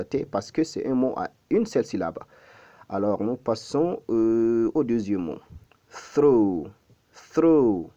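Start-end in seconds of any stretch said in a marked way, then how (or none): 6.1-7.9 "Through" with airy TH and long falling vowel; loud, drawn-out "Through", tongue between the teeth; model pronunciation. Shown as a demonstration. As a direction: blow air through the TH of "Through". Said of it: "comment le prononce-t-on".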